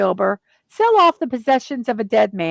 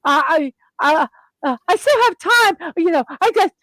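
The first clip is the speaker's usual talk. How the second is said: giddy Scrooge; 7 semitones higher than usual. Retold or imitated. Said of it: "He's like".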